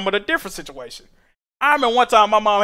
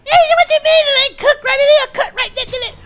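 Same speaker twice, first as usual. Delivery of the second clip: mama's voice, much higher and louder, dismissive; mocking mama voice; 6 dB louder than usual. Son imitating mom denying the food is undercooked, high mocking voice. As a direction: mimic his mom brushing him off, pitched way up. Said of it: "she automatically assume I'm lying".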